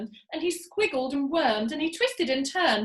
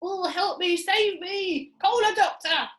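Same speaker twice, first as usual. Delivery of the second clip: higher, loud plea; yelling; 7 semitones higher, 5 dB louder, slower than usual. Acted. Mister Twit yells for a doctor. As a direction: call out for help. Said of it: "yelled Mister Twit".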